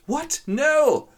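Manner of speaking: a high, loud disbelieving yell; shocked outcry. Acted. Ferdinand shouts in shock at his father. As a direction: leap up in pitch and shout no. Son hears he may marry.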